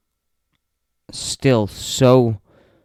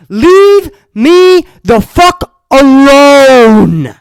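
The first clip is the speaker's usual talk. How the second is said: higher, louder yelling, last words dragged out; furious outburst; 11 semitones higher, 8 dB louder than usual. Real, not acted. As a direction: blow up at the guests. Imagined reply to guests.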